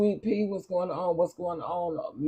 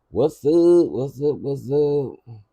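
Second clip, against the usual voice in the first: loud call-out; 7 dB louder, slower than usual. Real, not acted. Calling out to viewers.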